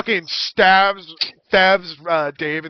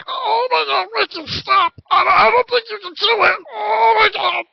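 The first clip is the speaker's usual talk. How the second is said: 1 panicked gnome Dave; 12 semitones higher than usual. Acted. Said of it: "And Dave's just going".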